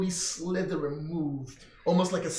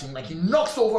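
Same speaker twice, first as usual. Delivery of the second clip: ordinary talk, nothing marked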